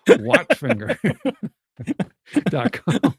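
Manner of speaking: helpless high, loud laughing words. Real, not acted. Co-host repeating the misheard website name, laughing hard.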